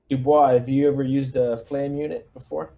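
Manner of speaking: normal talking voice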